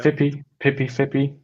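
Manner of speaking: Vippi as FIP-ee, Pippi as PIP-ee; trying out pronunciation. Shown as a demonstration. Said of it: "Do I pronounce that correctly?".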